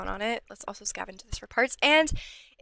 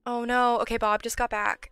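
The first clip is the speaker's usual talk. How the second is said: usual speaking voice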